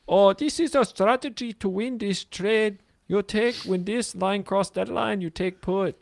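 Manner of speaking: high, slow mock Indian accent. Mocking YouTube trading gurus to co-host in an Indian accent. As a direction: ham up an Indian accent guru. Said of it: "That's Indian".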